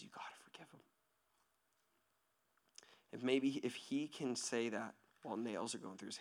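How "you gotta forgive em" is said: barely audible whisper; hushed, breath-only plea; whispered urging. Acted. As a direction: whisper it tenderly, almost no voice. Quotes Jesus in a whisper.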